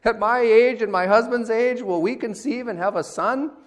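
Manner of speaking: high, loud incredulous question after a scoffing "Ha!"; scornful laughing disbelief. Acted. Sarah laughing to herself at the guest's promise, incredulous. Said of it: "she laughs to herself".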